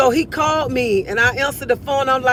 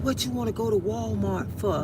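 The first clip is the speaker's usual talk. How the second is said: hushed question, much softer; whispering; 9 dB quieter than usual. Retold or imitated. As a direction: whisper it like a secret. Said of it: "why are you whispering".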